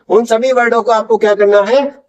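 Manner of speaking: ordinary delivery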